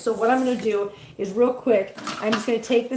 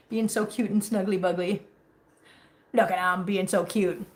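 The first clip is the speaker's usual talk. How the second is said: ordinary talk, nothing marked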